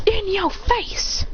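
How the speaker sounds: high, drawn-out taunt; acted gloating. Fighter gloating after pushing a rival back, high taunting voice. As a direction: rub it in, high and slow.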